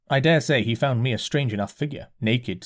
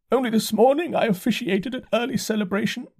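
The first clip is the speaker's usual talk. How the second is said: dazed curate; 11 semitones higher than usual. A narrator's reading. Curate voiced by narrator.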